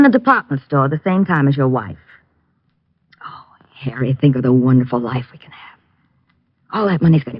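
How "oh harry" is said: breathy coaxing whisper. Acted. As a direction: lure him in softly. Scheming lover coaxing him.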